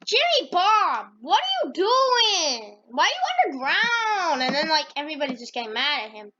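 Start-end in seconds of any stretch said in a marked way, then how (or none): 0.0-4.4 much higher, drawn-out calling with a laugh; high, slow chorus of bystanders. Acted. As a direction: call out to him, amused and baffled. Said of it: "everybody's like".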